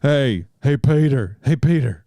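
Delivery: lightly voiced deadpan guy calling out to Peter; Patrick Warburton impression. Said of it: "I can't do Patrick Warburton's voice".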